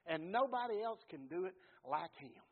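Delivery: ordinary delivery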